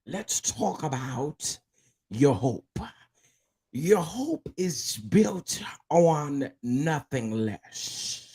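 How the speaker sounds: slow, drawn-out preaching; preacher lady voice. Acted. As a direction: preach it slowly like a church lady. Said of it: "put on my preacher lady voice".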